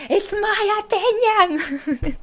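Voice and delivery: high, drawn-out scream; Melita. Acted. Mimicking someone screaming a defence of their opinion, screaming. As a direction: scream it out, stretching every word. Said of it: "Melita screaming".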